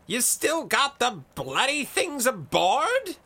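loud, high-pitched incredulous roar. A narrator's reading. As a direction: bellow the question slowly. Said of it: "roared Blazefeather".

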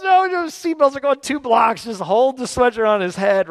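high, loud, flustered shouting; acted-out exasperated panic; frantic dad yell. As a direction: yell over the child's objection. Acts out frantic dad yelling back.

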